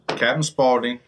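ordinary delivery

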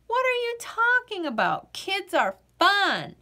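put-on high, outraged little girl; indignant kid protest. Girl protesting to the potato that kids are fun. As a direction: defend kids, shocked.

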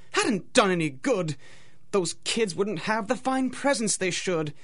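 usual speaking voice

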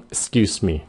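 brisk, joined-up "'scuse me"; quick and compact. Shown as a demonstration. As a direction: EXCUSE ME run together as "'scuseme". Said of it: "Nói nhanh gọn".

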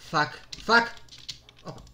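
ordinary talk, nothing marked

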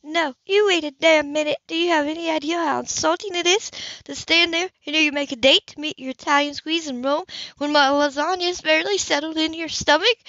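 outraged woman refusing to back down; angry Hannah. Acted.

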